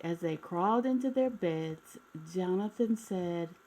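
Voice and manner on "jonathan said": usual speaking voice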